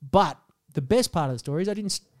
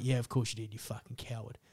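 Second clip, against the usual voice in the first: mock sneer; 5 semitones lower, 13 dB quieter than usual. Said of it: "That was my friend that said that".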